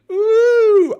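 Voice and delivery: loud, very high mocking "ooh"; imitated taunting "ooh". Rival fan just behind him going "ooh", taunting. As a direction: mimic a jeering fan with a piercing hoot. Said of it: "who was just behind me going".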